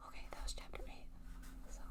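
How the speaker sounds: breathy whisper, no voice at all; whispered aside. Real, not acted. Reader whispers a side note to listeners, whispered.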